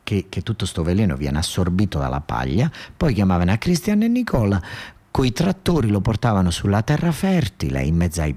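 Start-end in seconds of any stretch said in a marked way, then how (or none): none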